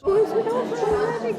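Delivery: a teasing gasp of surprise, half laughing; playful surprise. Real, not acted. Learner teasing the tutor as the class ends, high playful surprise.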